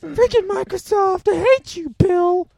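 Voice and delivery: loud, high-pitched hammed-up tirade; mock rage. Joker cursing Microsoft over the recording, mock rage.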